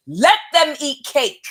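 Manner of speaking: high, loud, drawn-out crow; gleeful proclamation. Real, not acted. Tarot reader crowing over a missed opportunity, gleeful proclamation.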